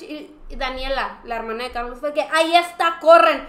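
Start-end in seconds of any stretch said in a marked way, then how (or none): 2.2-3.4 calling out loud to run for the merch cart; retold yell. Daniela shouting to the group to run, loud call.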